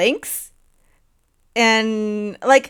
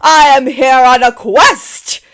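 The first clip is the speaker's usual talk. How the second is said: booming, beaming hello, much higher and louder; big beaming knight; 6 semitones higher, 10 dB louder, slower than usual. Acted. Cheerful knight beaming at the farmer and party, loud and high. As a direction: announce yourself like a grinning hero. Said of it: "seems to be smiling, like, real big".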